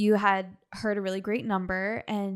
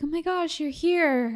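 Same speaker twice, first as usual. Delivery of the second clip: relieved surprise; 7 semitones higher, slower than usual. Retold or imitated. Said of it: "I remember you were like".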